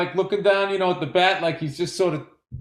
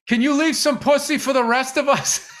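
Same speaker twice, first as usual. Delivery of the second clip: higher-pitched imitated plea to a stranger; mock pleading kid; 7 semitones higher than usual. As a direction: play the kid's cheeky request as a punchline. Punchline in the kid's voice.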